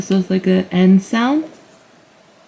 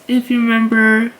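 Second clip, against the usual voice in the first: ordinary talk, nothing marked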